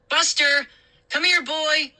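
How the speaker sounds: high calling to the dog. A narrator's reading. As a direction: call the dog across the yard. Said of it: "I called".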